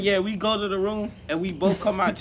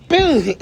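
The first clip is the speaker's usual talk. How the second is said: shouted impact sound; 8 semitones higher, 11 dB louder than usual. Retold or imitated. Acting out the kick.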